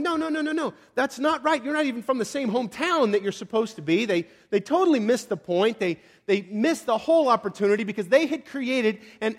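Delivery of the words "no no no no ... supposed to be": high, fussy rapid-fire refusal; objecting. Acted. Onlookers rejecting an unexpected Messiah, objecting. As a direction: protest in a raised, put-on pitch. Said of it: "they're just going".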